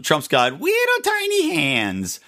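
high, drawn-out taunting singsong; sing-songy teasing. Retold or imitated. Mocking a TV host's jab at Trump, sing-songy teasing. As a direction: imitate a slow, sing-song taunt. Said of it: "in the sing-songy teasing way".

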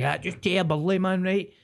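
high, breezy fake confidence; unsure fake confidence. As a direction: bluff it cheerfully. People pleaser faking directions.

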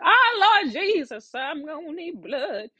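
high, wailing mock panic; retold panic. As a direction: wail it high, hammed up. Retold panic at blood type question.

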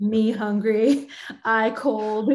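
slow, loud, primitive cave woman talk; mock cave woman, drawn-out simple words. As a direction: grunt out each word like a cave woman. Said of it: "what I call cave woman Irish".

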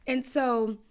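normal talking voice

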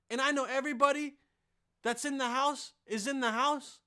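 loud threatening rant; angry rant. Pretend. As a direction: menace an intruder, yelling. Rants at imagined intruder.

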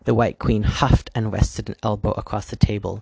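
ordinary delivery, nothing marked

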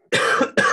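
sudden hacking cough; coughing fit. Real, not acted. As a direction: cough hard, then carry on. Sick speaker coughing mid-sentence.

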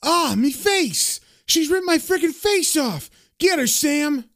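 high-pitched wail of pain and outrage; hammed-up high, panicked cry; acted yell. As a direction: yell out as if just clawed, no real volume. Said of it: "And Mickey yells out".

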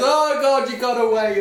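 hammed-up copper letting the kids escape; mock chase. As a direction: pretend the kids got the better of you. Policeman pretending to chase kids.

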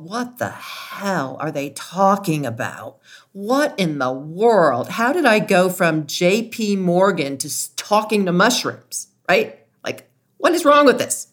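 mock bewildered outburst. Host voices someone baffled by talking to mushrooms, mock incredulity. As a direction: ham up the disbelief, rising into a squeal.

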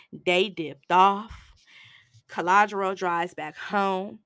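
usual speaking voice

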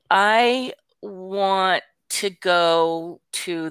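very slow, drawn-out words; slow child's answer, imitated. Granddaughter saying what she wants, slow imitated answer.

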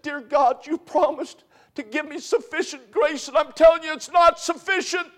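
a grieving father's cry, pitched high; anguished prayer. Retold or imitated. Retelling the old pastor crying out to God, high and anguished. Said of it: "I couldn't stop crying".

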